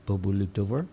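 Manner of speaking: ordinary delivery, nothing marked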